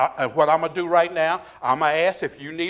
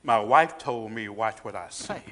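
low, soft confiding aside; sheepish low admission; hushed aside; 9 semitones lower, 6 dB quieter than usual. Real, not acted. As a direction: drop the voice way down. Confiding aside about his wife.

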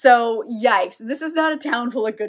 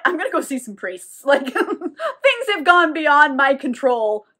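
laughing retold quote; 3 semitones higher, 4 dB louder than usual. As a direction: break into a laugh mid-quote. Teller cracks up voicing Vittorio.

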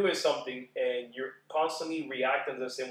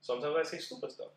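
ordinary delivery

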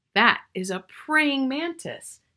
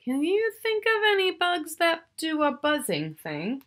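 usual speaking voice

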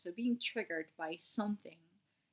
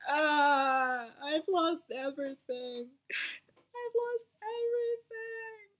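high, loud wail through tears, then slower; crying; 8 semitones higher, 10 dB louder, slower than usual. Retold or imitated. Telling a friend about her breakdown, crying. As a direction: sob out the loss. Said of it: "I was like".

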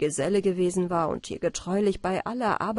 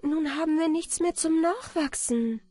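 higher-pitched, insistent voice; exclaiming girl; 7 semitones higher than usual. A narrator's reading. Anna Barbara tells him the bread is gone, exclaiming. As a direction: call it out higher, reminding him. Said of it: "Anna Barbara aber rief".

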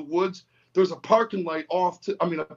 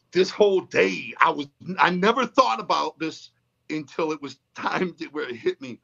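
ordinary delivery, nothing marked

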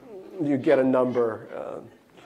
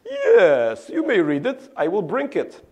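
put-on eager immigrant boy, Russian accent; thick Russian accent; 5 semitones higher, 6 dB louder, slower than usual. Boy promising teacher his novel, thick Russian accent. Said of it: "my Russian accent is still thick".

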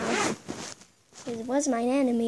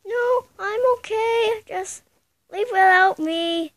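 higher, slow toy voice; character voice; 6 semitones higher, 4 dB louder, slower than usual. Pretend. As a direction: voice a shy little buddy. Toy character answers friend.

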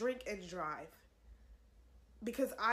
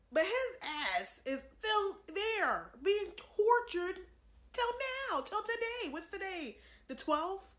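higher, emphatic preaching with stretched key words; worked-up and urgent, voice pitched up; impassioned outrage; 5 semitones higher, slower than usual. Real, not acted. Warning viewers that Hitler is still in hell, impassioned.

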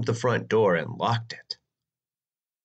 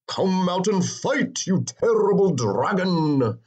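shouting knight; 8 semitones higher, 3 dB louder than usual. Acted. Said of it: "shouted the knight".

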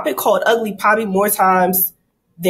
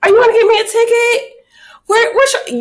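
high, sugary flirt with the officer; flirty sweet voice; 14 semitones higher, 3 dB louder than usual. Retold or imitated. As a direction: bat your lashes through the words. Flirting to dodge ticket.

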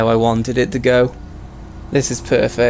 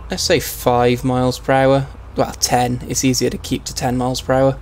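usual speaking voice

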